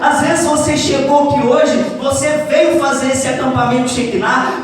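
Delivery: loud, ringing preacher's call over music; full-force preaching. Acted. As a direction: preach it out to the whole camp.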